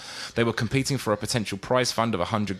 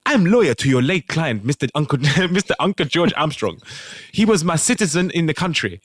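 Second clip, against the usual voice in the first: mock West African accent; 5 semitones higher than usual. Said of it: "I'm gonna do an accent".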